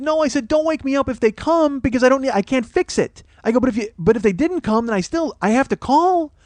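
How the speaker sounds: high-pitched indignant protest; frantic retold complaint; exasperated arguing. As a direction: argue back in a high, worked-up voice. Retold quarrel, arguing back.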